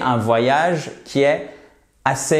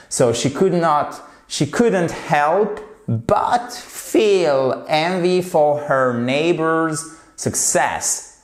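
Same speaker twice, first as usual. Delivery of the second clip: slow, carefully spaced English sentence; slow English model reading; 3 semitones higher, slower than usual. Shown as a demonstration. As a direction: read it slowly for the class to copy. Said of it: "Et cette phrase, elle est extrêmement intéressante". (lecture lente et appliquée en anglais)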